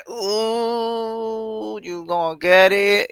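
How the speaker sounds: mock sassy singsong; playful taunting tone. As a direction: tease them, sassy and slow. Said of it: "That's me".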